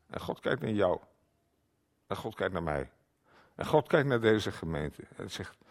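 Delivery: ordinary talk, nothing marked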